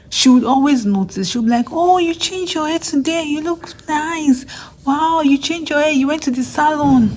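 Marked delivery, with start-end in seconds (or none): 1.7-7.1 high, gushing compliments; gushing lab mate. Retold or imitated. Lab mate gushing over the speaker's new hairstyle, high and excited. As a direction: imitate her gushing over the new hair.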